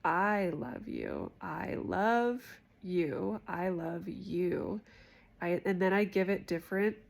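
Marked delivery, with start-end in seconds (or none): 0.1-1.3 "I" drawn out slowly as the key word; stress on I. Shown as a demonstration. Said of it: "I emphasize a different word". 1.4-3.4 "love" stretched out very slowly; stress on LOVE. Shown as a demonstration. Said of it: "I emphasize a different word". 3.5-4.8 slow, with "you" held out at the end; stress on YOU. Shown as a demonstration. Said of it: "I emphasize a different word".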